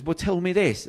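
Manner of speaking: high-pitched retold demand; challenging doubter. Doubter in the pub testing the man, challenging. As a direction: imitate a sceptic pressing for answers, pitch right up.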